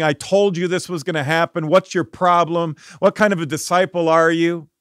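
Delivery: loud, exasperated scolding of a failed disciple; harsh scolding. Pretend. A pretend Jesus loudly berating Peter. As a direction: hammer him with accusing questions. Instead of saying.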